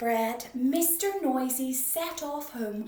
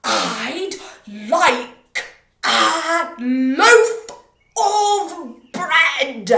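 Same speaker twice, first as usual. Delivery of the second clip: booming roar, far louder; 4 semitones higher, 18 dB louder, much slower than usual. Acted. Mister Noisy roaring his order at the baker, booming. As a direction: bellow the order across the shop. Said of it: "roared Mister Noisy".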